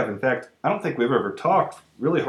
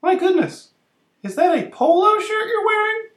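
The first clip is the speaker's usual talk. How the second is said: high, louder shocked exclaim; 4 dB louder than usual. Acted. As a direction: gasp it out in disbelief. Shocked at the polo shirt.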